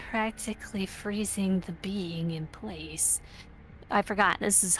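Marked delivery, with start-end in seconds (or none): 0.1-3.2 hushed, eerie whisper; spooky whisper. Acted. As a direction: whisper it like a ghost story. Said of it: "I won't be spooky anymore".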